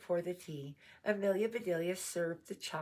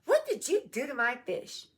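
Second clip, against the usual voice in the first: high, loud outraged demand; storybook bellow of outrage; angry shout; 9 semitones higher, 8 dB louder than usual. A narrator's reading. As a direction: shout it as an accusing question. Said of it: "He shouted".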